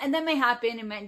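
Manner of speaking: usual speaking voice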